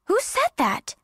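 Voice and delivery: high, alarmed question; startled logger. Acted. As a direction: pitch jumps up in bewilderment.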